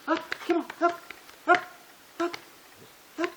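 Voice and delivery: high, excited call to the dog; coaxing dog call. Real, not acted. Trainer calling his dog to jump up on him, high and excited. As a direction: coax the dog up with a high, eager voice.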